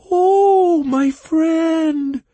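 piercing, very high, loud cry; creature's yell. A narrator's reading. Bog creature spotting an old friend, yelling. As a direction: shriek it in a tiny creature voice. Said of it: "in turn yelled the creature".

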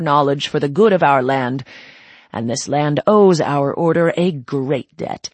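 loud, well-worn ceremonial drone; droning speech. Acted. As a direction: recite it like a speech given a hundred times. Princept continues his speech.

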